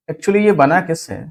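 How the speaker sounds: usual speaking voice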